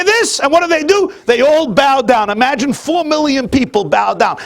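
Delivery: high, loud, ringing sermon delivery; preaching at full force. Acted. Preacher lays out the verse to the congregation, full force. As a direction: preach it out to the whole room.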